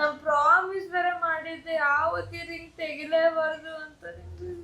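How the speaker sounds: soft, tearful lament; real tears in a softened voice; crying. Real, not acted. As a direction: trail into quiet crying. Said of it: "ಅಳ್ಬೇಡಿ ಆಯ್ತು".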